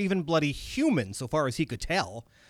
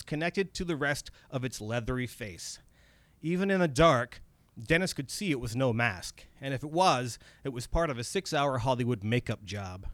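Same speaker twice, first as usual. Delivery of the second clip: usual speaking voice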